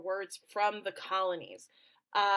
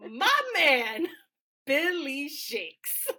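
loud, higher-pitched adoring gush; gushing fangirl; 5 semitones higher, 7 dB louder, much slower than usual. Real, not acted. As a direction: draw out each name with relish. Gushing over favourite writer Shakespeare.

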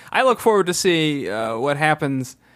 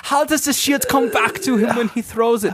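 put-on higher, light German accent; 7 semitones higher than usual. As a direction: ask it as a puzzled German customer. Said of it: "my German accent".

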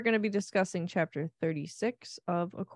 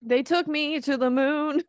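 teasing misreading; 6 semitones higher, 5 dB louder than usual. Pretend. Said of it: "That says told".

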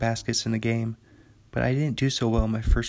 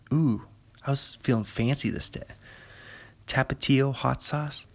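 usual speaking voice

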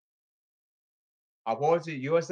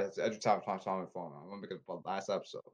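low, soft, fast aside; muttered aside; 7 semitones lower, 10 dB quieter, faster than usual. Real, not acted. Vlogger apologizing about his phone, soft quick aside. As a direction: mumble it to yourself, hurried.

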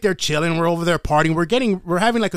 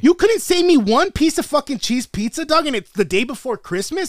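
high-pitched retold outrage; indignant retort; 6 semitones higher than usual. Kid cussing out brother over pizza, indignant.

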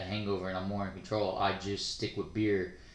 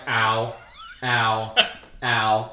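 mock pain; 6 dB louder, much slower than usual. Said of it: "you're just like".

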